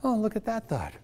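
soft, detached observing, lightly amused; gentle mock-marvel at a passing thought; calm noticing voice. Shown as a demonstration. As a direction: watch the thought drift by. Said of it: "that's what noticing your thoughts are".

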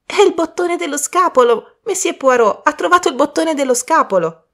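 high, loud, delighted announcement; excited exclamation. Acted. Girl announces Poirot has found the bachelor's button, excitedly exclaiming. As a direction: call out the discovery to the whole table. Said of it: "esclamò Bridget".

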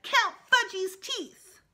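put-on high, loud and slow toddler plea. A narrator's reading. Fudge begging the dentist in a slow high toddler voice. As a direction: drag it out like a pleading toddler.

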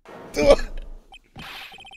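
put-on high breathy old-lady titter; old lady giggle. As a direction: giggle like a flattered granny.

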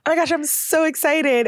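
high gushing squeal; mock excitement. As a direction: gush like you're thrilled. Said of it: "what if I'm like".

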